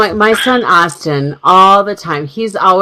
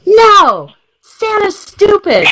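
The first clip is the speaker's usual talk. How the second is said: high-pitched mock toddler shout; defiant toddler outburst; 3 dB louder, slower than usual. Mother voicing her two-year-old refusing Santa, defiant high shout. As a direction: blurt it out like an indignant little kid.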